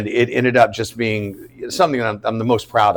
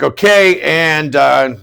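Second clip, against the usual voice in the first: louder, higher set announcement; crew callout; 4 semitones higher, 5 dB louder, slower than usual. Retold or imitated. Crew readying the set before action, calling out. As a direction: imitate a crew calling out the roll.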